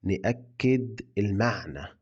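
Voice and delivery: slow, clear model line for learners; slow repeat. Shown as a demonstration. Teacher repeats the phrase slowly for learners, slow and clear. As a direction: say it slowly so students copy. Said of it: "يلا نقولها تاني".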